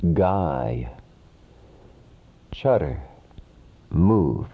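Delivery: usual speaking voice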